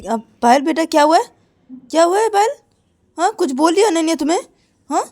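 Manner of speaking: a mother asking her daughter what's wrong; concerned mother. Acted. Mother asking Payal at dinner, high worried questions. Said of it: "Ananya की mummy पायल को बोलती".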